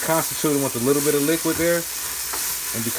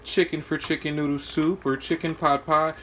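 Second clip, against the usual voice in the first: mocking culinary student; 6 semitones higher than usual. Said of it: "some culinary students will be like".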